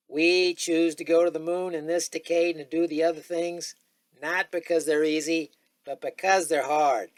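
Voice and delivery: high, loud, ringing oration; grand presidential call to the nation; Kennedy impression. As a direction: deliver it like the moon speech at Rice. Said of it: "Okay, here's Kennedy again".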